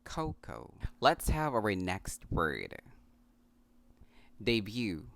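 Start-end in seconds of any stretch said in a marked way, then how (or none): 0.0-0.7 "cocoa" as KOH-koh; two syllables in "cocoa", both oh; correct pronunciation. Shown as a demonstration. 4.4-5.1 "Debut" as day-BYOO; y glide in "Debut": day-byoo; correct pronunciation. Shown as a demonstration. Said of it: "the correct way to say this".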